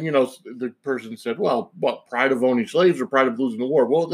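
usual speaking voice

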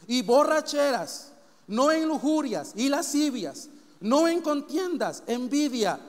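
slow, emphatic preacher's reading; solemn list, stretched word by word; scripture reading. Real, not acted. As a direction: draw out each sin for weight. Reads scripture aloud.

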